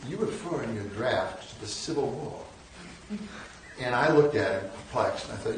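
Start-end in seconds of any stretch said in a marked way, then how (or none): 0.1-3.2 put-on slow, half-hearted Southern accent from a self-confessed Yankee; Justice Powell's Southern drawl. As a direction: lightly imitate an old Virginia judge's Southern accent, slow. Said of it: "so I can't do this accent".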